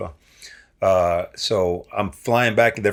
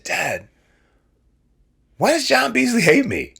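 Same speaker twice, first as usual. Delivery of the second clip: plaintive high question. Retold or imitated. Retold question to his dad.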